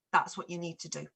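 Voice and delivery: ordinary delivery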